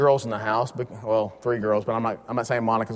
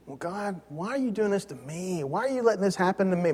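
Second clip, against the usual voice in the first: higher, softer mock whimper; whining to God; 6 semitones higher, 5 dB quieter than usual. Believer grumbling to God, mock whine. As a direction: complain like a sulky child. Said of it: "we complain".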